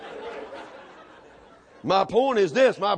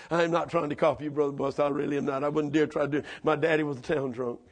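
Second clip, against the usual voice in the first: normal talking voice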